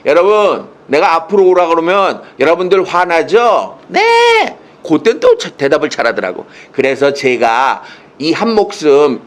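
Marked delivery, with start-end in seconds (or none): 3.9-4.6 mock student reply.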